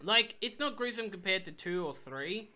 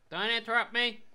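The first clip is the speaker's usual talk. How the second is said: annoyed outburst; 6 semitones higher, 7 dB louder than usual. Real, not acted. Gamer snaps at game character.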